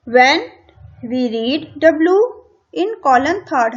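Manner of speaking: reading English instructions slowly, word by word; slow textbook reading. Shown as a demonstration. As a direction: read it out deliberately for the class. (किताब से धीरे-धीरे पढ़ना)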